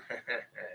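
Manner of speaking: soft, low laugh; low chuckle. Real, not acted. Joking about being allergic to lube, a low chuckle. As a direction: chuckle quietly to yourself.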